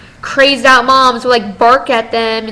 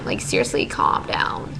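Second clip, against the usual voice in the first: whisper; 11 dB quieter than usual. Real, not acted. Muttering about the pushy girls.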